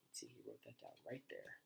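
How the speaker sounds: soft whisper to herself; whispered aside. Real, not acted. Reader checking the picture aloud, in a whisper. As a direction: whisper while pointing at the page. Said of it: "unvoiced whisper".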